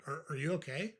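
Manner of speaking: softly puzzled question, lifting at the end; puzzled concern. Shown as a demonstration. Coach repeats the comeback as a model, puzzled concern. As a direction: check on them as if something must be wrong. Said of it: "say it just like I just said it".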